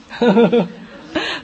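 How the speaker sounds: short real chuckle; amused laugh. Real, not acted. Pastor laughing to the congregation at the booklet, short chuckle.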